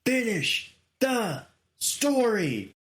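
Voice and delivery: slow, high, drawn-out commentator call; imitating a commentator's emphatic slow call; Michael Cole calling it. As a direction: stretch it like a big commentary moment. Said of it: "you hear Michael Cole on commentary as".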